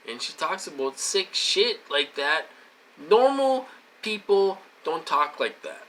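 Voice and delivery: rising indignation, hammering each point; heated rant. Real, not acted. Ranting to viewers about a girl on the calls, heated and loud.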